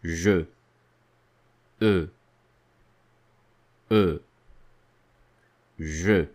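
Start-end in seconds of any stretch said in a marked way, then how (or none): 1.8-6.3 E then Je with a schwa; teacher models Je; schwa demo. Shown as a demonstration. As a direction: build Je from E. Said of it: "This sound is present in the first word".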